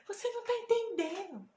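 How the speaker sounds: high, loud indignant protest; retold flustered, annoyed outburst; imitated irritation. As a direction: act out a woman getting worked up. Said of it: "ela ficou um pouco irritada com o que eu falei".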